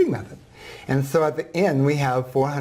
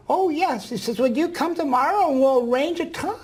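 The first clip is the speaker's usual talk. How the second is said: put-on old woman voice; 13 semitones higher than usual. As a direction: voice the ninety-four-year-old gently. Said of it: "I'm gonna use a voice that s-, sounds like her".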